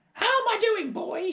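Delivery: very high, loud hag voice; gloating crone shout. Acted. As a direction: crow down from the roof. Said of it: "she shouted".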